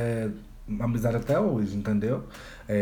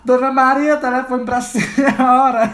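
high, loud tearful call, half laughing; a scared kid calling the neighbour through tears; crying while talking; 9 semitones higher, 7 dB louder, slower than usual. Retold or imitated. A child crying out to Dona Maria about the phone. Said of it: "e chorando".

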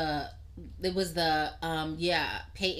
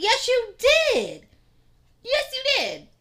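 very high, slow, teasing repeat; hammed-up comeback to grandma; mock insisting; 14 semitones higher, 5 dB louder, slower than usual. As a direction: drag out the accusation playfully. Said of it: "I was like".

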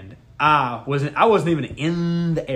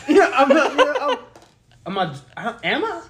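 laughing; 11 semitones higher than usual. Real, not acted. Laughing, high, retelling confusion.

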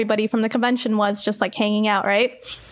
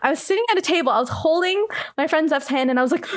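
usual speaking voice

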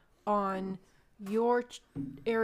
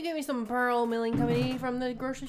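higher, louder sugary request; sweet spouse voice; 4 semitones higher, 6 dB louder than usual. Pretend. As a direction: ask your honey sweetly. Acting a spouse asking for syrup.

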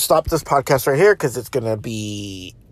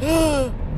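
mock gasp; 12 semitones higher, 3 dB quieter than usual. Everyone's shocked face.

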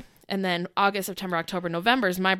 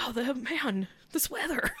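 higher, softer mock small talk; imitated chatty dad; 5 semitones higher, 8 dB quieter than usual. Dad chatting while walking bride down aisle, imitated casualness. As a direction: make idle small talk under your breath.

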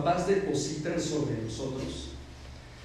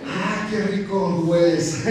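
mock seductive; 2 semitones higher, slower than usual. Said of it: "Y entonces la persona".

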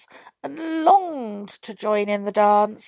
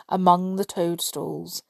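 plain reading voice

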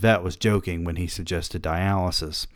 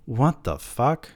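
stunned; 4 semitones higher, slower than usual. Real, not acted. Reacting to vacuuming ashes.

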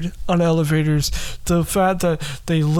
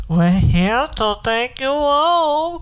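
slow, drawn-out take of the captor line; slower abductor voice; 7 semitones higher, slower than usual. Retold or imitated. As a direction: stretch it out like a menacing captor. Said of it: "it would be like this".

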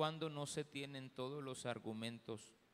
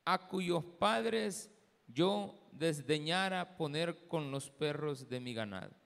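solemn, projected Bible reading with measured phrases; declaimed scripture, firm and deliberate; 7 dB louder than usual. Real, not acted. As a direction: read the passage out to a congregation, each phrase set apart. Reads scripture aloud to congregation.

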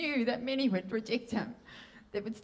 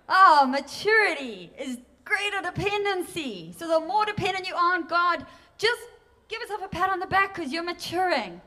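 high, loud, ringing preaching; full-force preaching; 7 semitones higher, 5 dB louder than usual. Real, not acted. Preacher urging her congregation on, loud and high. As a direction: lift it up and let it ring.

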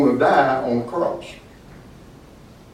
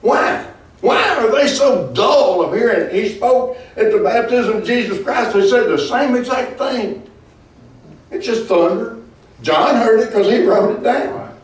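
fiery preaching; 6 semitones higher than usual. Acted. Preacher rises in indignation.

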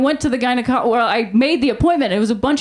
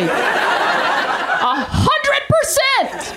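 laughing; 11 semitones higher, much slower than usual. Real, not acted. Comic emphatic agreement.

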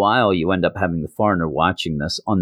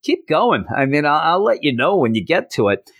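higher, dry sarcasm; sarcastic; 6 semitones higher than usual. Retold or imitated. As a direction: brush it off, mocking. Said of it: "He's like".

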